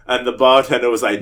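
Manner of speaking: normal talking voice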